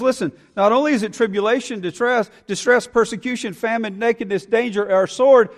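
ordinary talk

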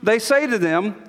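normal talking voice

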